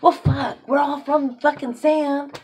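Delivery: loud, high, exasperated outburst; fired-up rant; worked up. Real, not acted. Ranting about everyone being equal.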